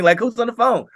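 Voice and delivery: high, puzzled who's on the phone; mock baffled question; surprised question. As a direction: voice jumps up in confusion. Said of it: "I was like".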